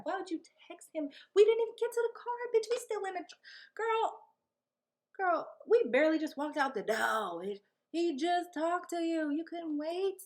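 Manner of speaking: exasperated. Real, not acted. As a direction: rant at her, high and fired up. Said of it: "Oh, I was so mad".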